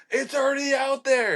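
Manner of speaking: high-pitched mock yell back; retold frantic reply. Retelling his answer to the captain mid-bite, frantic mock yell.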